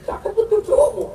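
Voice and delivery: high mock admiration; fake-impressed and high; a teasing, exaggerated compliment to a student; sarcastic praise. Mocking student's wallpaper.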